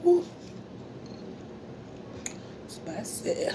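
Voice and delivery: usual speaking voice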